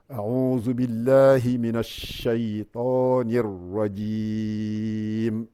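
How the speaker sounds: slow, chanted recitation with long held vowels; Quranic recitation. Acted. Teacher reciting the surah slowly for students, chanted. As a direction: recite the Fatiha slowly and melodically.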